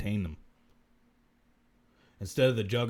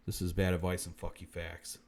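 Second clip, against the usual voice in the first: ordinary delivery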